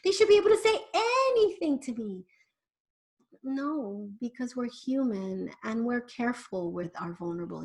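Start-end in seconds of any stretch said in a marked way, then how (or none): 0.0-2.2 higher, mildly indignant parent protest. Retold or imitated. Parent objecting to Lena that kids should tell them everything, higher and indignant. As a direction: protest like a parent who feels entitled. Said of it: "a lot of you are like".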